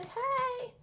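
tiny squeaky high hey; a dog saying hello; dog's voice. Pretend. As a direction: owner voicing her dog's hello, very high.

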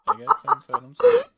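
high, laughing apology. Real, not acted. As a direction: say it while still cracking up. Still laughing after outburst.

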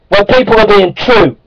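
ordinary delivery

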